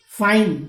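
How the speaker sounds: फाइन loud, long ai glide; say fine. Shown as a demonstration. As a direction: call out फाइन clearly. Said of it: "फाइन ले लीजिए". (फाइन का उच्चारण ज़ोर से)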